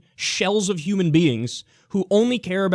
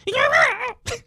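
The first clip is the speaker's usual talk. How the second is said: laugh. Real, not acted. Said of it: "I like how my voice cracked there".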